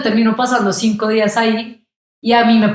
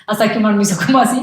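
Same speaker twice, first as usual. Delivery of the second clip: usual speaking voice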